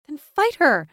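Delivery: high, loud, fierce urging; fiercely insistent outburst. A narrator's reading. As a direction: throw it out as a battle challenge. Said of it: "Yana said fiercely".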